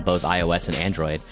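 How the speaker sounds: ordinary talk, nothing marked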